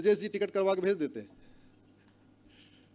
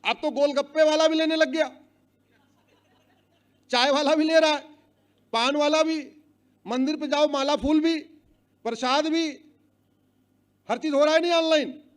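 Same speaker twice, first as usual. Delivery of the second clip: raised rally voice; 5 semitones higher, 5 dB louder than usual. Real, not acted.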